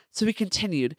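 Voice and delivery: usual speaking voice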